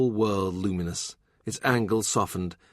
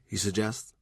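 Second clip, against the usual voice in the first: ordinary delivery, nothing marked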